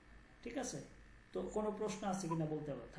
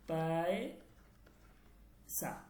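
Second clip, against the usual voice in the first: পয়সা stretched very long and slow; slow drawn out word; 3 semitones lower, 4 dB quieter, much slower than usual. Shown as a demonstration. Teacher modelling পয়সা for students, very slow. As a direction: draw the word পয়সা out syllable by syllable. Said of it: "এটা উচ্চারণ হচ্ছে এই".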